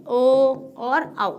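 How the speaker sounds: ओ and औ held long and slow; drawn-out long vowels. Shown as a demonstration. Teacher drawing out long vowels for the children. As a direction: stretch each long vowel very slowly. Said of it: "ये दीर्घ स्वर होते हैं".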